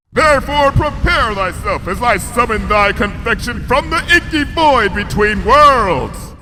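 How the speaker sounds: high, loud incantation, slowing into a shout; grand summoning. A narrator's reading. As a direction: intone it like a dark spell. Princess summoning a pie dramatically.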